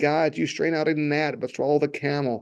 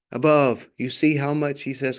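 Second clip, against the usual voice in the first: normal talking voice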